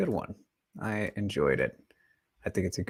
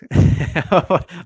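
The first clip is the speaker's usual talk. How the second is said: laughing; 8 semitones higher, 9 dB louder than usual. Real, not acted.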